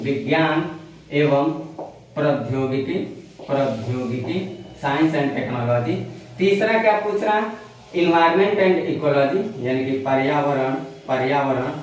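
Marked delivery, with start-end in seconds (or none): 0.0-5.9 each word drawn out and separate; slow dictation. Shown as a demonstration. Teacher dictating a syllabus term to students, slow and deliberate. 8.0-11.7 drawn out, word by word; slow dictation. Shown as a demonstration. Teacher spelling out syllabus terms for students, very slow.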